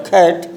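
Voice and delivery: "खैट" with a puff of air on the first sound; bursting K. Shown as a demonstration. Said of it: "कै और खे के बीच का sound". ("खैट" की शुरुआत फटी आवाज़ में, कै और खे के बीच)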